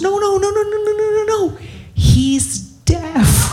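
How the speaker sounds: high-pitched rush of frantic protest; imitated protesting wife. Wife hurriedly defending her husband to the comic, mock alarm. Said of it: "his wife goes".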